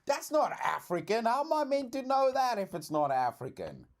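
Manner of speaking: high-pitched, defensive protest; real exasperated squeal of self-defence; flustered protest. Real, not acted. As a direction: voice shoots up high as you defend yourself. Protests being expected to know Haitian.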